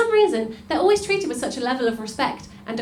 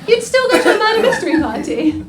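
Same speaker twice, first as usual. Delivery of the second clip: normal talking voice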